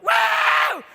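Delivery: put-on high, loud drunken whoop; rowdy whoop. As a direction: whoop like a drunk hitting the bar. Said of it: "we'll walk into a bar like".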